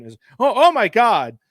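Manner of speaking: usual speaking voice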